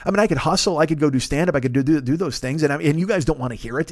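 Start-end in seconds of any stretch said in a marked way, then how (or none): none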